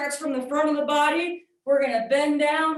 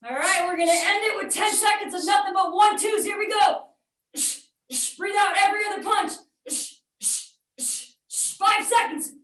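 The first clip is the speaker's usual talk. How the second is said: shouting over workout; 6 semitones higher than usual. Real, not acted. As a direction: push the class to finish strong. Shouting final seconds of workout.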